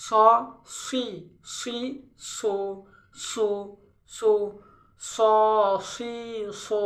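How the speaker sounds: স, সি, সো with a heavy s and rounded vowel: so, swi, su; thick s. Shown as a demonstration. Teacher demonstrating the letter sad, thick heavy s. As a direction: thicken each s in স, সি, সো, one syllable at a time. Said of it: "একটু মোটা হবে".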